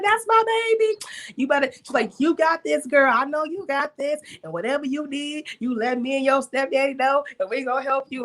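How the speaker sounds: high, proud, loving mother voice; be the mom cheering her daughter on. Retold or imitated. Mother cheering on her daughter's new studio in a high, proud voice.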